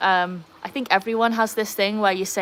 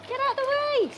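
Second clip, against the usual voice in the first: very high squeal of a command; high, wailing plea; exasperated calling out. Real, not acted. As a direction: squeal at the dog to move. Shooing the dog again.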